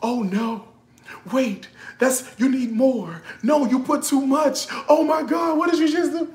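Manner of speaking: mock panic building to a shout; alarmed reaction. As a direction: play the horrified viewer watching a video. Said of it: "I'm like".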